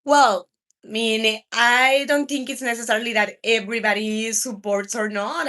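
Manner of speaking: normal talking voice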